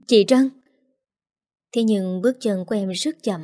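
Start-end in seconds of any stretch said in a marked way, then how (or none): 0.1-0.5 higher, louder choked cry; choked-up call. A narrator's reading. Brother limps toward his sister, calling her, choked up. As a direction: call out through a tightening throat. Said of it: "giọng nghẹn cả đi".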